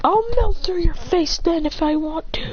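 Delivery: high, slow strained threat; mock anime fighter's menace; acted battle threat. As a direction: draw the threat out high-pitched. Character threatens mid-attack.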